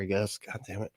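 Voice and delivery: ordinary talk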